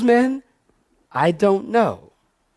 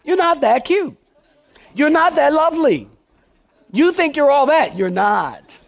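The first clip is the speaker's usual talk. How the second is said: higher, laughing mockery; laughing tease; 5 semitones higher than usual. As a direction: poke fun at them with a grin. Teasing congregation, laughing.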